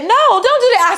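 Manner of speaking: high, loud frantic plea; acted panicky protest; imitated alarmed nurse. Nurse begs her to stop.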